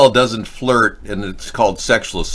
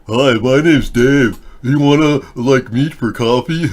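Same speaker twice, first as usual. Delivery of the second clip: slow, awkward mock pickup line, pitched up a little; mock shy guy; 4 semitones higher, 5 dB louder, slower than usual. Streamer mocks timid men asking for coffee, awkward mock voice. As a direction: play a nervous guy asking a woman out. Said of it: "woman and go".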